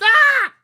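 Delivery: put-on shrill high toddler shriek, full volume; toddler scream. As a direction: scream like a tot whose balloon popped. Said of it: "And he screamed".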